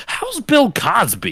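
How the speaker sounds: high, slow mock-innocent question; oblivious thawed man. Thawed man asks after a celebrity, mock innocent. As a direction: draw it out high, imitating cluelessness.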